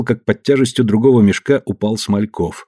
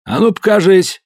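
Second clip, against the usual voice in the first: commanding; 10 semitones higher, 3 dB louder than usual. Acted. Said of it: "приказал хозяин".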